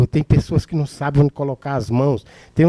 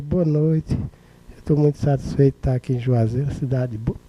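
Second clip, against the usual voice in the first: lifeless orator. Acted. Orator opening a speech in Juazeiro, lifeless and subdued. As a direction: greet the crowd with no spark. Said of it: "Imagina um orador que chega".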